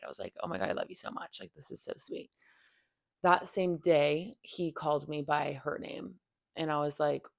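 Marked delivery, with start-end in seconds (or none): 0.4-2.3 soft, breathy near-whisper of being moved; hushed, as if choked up by the letter; retold teary gush. Remembering reading his love letter, hushed teary gush. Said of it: "I, like, literally cried".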